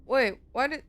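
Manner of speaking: normal talking voice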